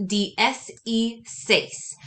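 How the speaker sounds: "D S E seis" spoken very slowly, each letter separate; slow spelled-out model. Shown as a demonstration. Teacher spells sixteen slowly for note-taking. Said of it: "the way you're gonna write this".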